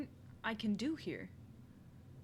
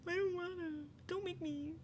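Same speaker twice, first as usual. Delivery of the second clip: put-on high, childish pleading whine; mock whining; 9 semitones higher, 4 dB quieter than usual. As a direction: whine and plead like a reluctant kid. Repeats the refusal as a whine.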